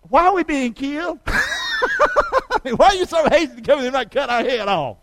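high, pleading, half-laughing question; frightened wise man laughing nervously at the captain; mock frantic plea. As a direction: beg the captain to explain, with a laugh.

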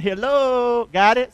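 ordinary talk, nothing marked

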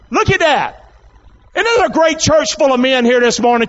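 high, excited preacher's call; rousing preaching. Real, not acted. Preacher hailing a church full of men, rousing.

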